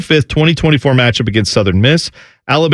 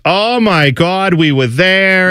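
hint of a drawn-out, higher New Jersey aunt; 5 semitones higher, slower than usual. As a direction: lightly play the New Jersey aunt, slow and high. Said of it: "my Aunt Gina, it's just".

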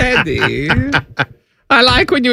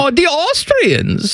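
put-on German accent; 8 semitones higher than usual. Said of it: "my German accent is non-sexual".

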